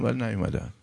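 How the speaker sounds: usual speaking voice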